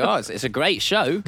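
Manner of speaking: hammed-up fifties British comic; music hall comedian. [name] talking up the show, high music-hall comedian impression. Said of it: "like a sort of British music hall comedian".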